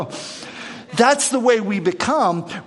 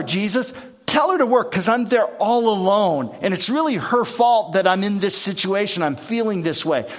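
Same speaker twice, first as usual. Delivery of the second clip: demanding; 4 semitones higher than usual. Retold or imitated. Martha demanding Jesus act.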